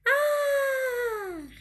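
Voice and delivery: "ar" sung out high and stretched; drawn-out high "ar"; long-held AR. Shown as a demonstration. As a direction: hold "ar" high as in a call. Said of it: "Eu fiquei mais tempo no".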